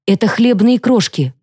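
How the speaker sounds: с озарением, выше; подчеркнуть «хлебные»; восклицание. A narrator's reading. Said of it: "воскликнула я". (радостная догадка)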